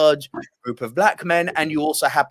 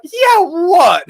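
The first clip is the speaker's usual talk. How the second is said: loud, higher mock challenge, yeah what; confrontational challenge; 11 semitones higher, 7 dB louder than usual. Staring down the phone filmer in the cinema, loud and challenging. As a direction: square up to him with a sneer. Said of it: "looked down at him and went".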